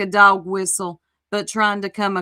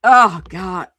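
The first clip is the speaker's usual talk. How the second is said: exasperated groan; 9 dB louder than usual. Real, not acted. Groaning at cringe video.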